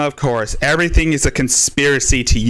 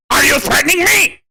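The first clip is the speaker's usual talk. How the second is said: high cartoon whine; mocking high accusation; Cornholio voice; 9 semitones higher than usual. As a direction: squeal it like the cartoon. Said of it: "I am Cornholio!".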